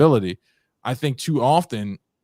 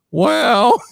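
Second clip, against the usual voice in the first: a mock Southern drawl on the word, pitched up and louder; Southern accent; 10 semitones higher, 6 dB louder than usual. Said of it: "I put a Southern accent on".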